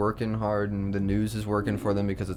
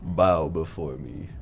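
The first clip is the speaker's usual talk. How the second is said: dark lord; 5 semitones lower, slower than usual. Pretend. Reading a meme in character.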